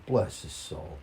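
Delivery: ordinary delivery, nothing marked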